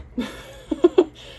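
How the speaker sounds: high breathy nervous giggle. Real, not acted. Reader admitting being perceived is scary, nervous giggle. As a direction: let a nervous airy giggle out.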